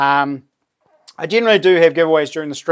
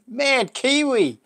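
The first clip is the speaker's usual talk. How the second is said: excited; 9 semitones higher, much slower than usual. Real, not acted. Reading out a big super chat.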